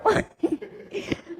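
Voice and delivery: high unvoiced burst of laughter; breathy laugh. Real, not acted. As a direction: let the laugh roll out. Laughter after punchline.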